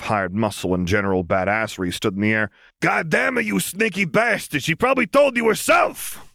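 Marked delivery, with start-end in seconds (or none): 2.8-6.3 angry vampire curse. Acted. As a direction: spit it out like a sore loser.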